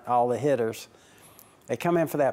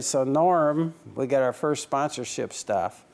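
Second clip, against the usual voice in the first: ordinary talk, nothing marked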